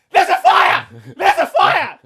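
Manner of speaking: high, loud alarm calls; panicked shout. Retold or imitated. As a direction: yell the warning across the flat. Shouting to girlfriend about fire.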